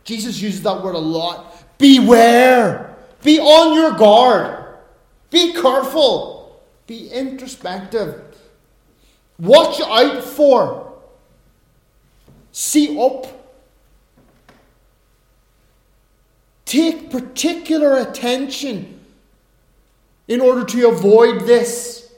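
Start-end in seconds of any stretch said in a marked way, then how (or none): 1.8-3.0 Beware called out high and loud; preacher crying out Beware; urgent loud warning. Shown as a demonstration. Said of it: "That's a great word". 3.2-22.1 higher, louder, slow warning; preacher urging the congregation to watch out; fiery preaching. Acted. Preaching at full force.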